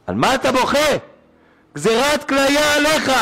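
high-pitched retold reproach; acted rebuke. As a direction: act out an alarmed scolding.

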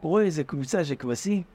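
ordinary delivery

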